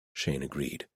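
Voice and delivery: ordinary delivery, nothing marked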